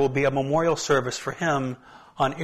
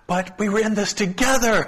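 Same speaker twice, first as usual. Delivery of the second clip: pleading; 7 semitones higher, 4 dB louder than usual. Retold or imitated. Colleague quoted pleading.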